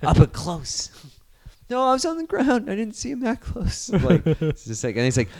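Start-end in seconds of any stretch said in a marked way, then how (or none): 0.0-0.9 knowing, accusing jab; mock-menacing insinuation; pointed needling. Dad needles teen knowingly. 1.7-3.9 very high imitation of a cornered teen; mock-nervous, pitched way up; nervous denial.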